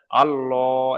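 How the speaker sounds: আল্লাহ with a round, heavy L; a full, heavy আল্লাহ; heavy rounded Allah. Shown as a demonstration. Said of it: "এটা হলো পুর".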